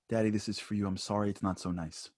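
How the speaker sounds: lightly voiced embarrassed four-year-old offering a card; embarrassed little boy. Son apologising to his father for his card, softly embarrassed.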